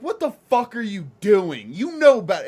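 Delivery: high, loud furious rebuke; scolding a friend with a knife; angry scolding. Retold or imitated. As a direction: snap at him for the knife. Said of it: "I'm like".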